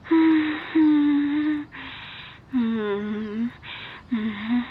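loud closed-mouth moans, easing off; humming moans. Acted. Performing for a listening lover, humming moans. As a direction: moan through closed lips. Wordless moans in intimate roleplay.

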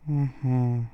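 ordinary delivery

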